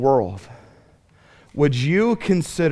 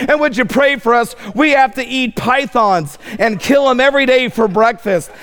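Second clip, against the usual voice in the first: a teasing hint of a begging missionary; mock missionary plea; 7 semitones higher than usual. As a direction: poke fun at a visiting missionary asking for prayer. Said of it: "he would say".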